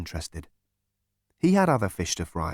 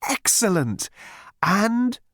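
much higher, sing-song soothing voice; chirpy psychiatrist; 8 semitones higher, much slower than usual. A narrator's reading. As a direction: purr reassurance to a violent patient.

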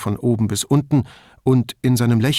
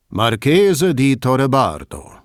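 TV showmaster; 2 semitones higher, 4 dB louder, slower than usual. Acted. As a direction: present the name like a game-show host. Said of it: "wie ein Showmaster im Fernsehen".

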